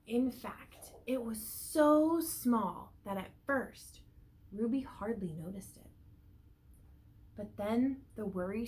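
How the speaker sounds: slow, expressive storybook reading; reading to children. A narrator's reading. Teacher reading a picture book to the class, lively storybook voice. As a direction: read it out to a class of little ones.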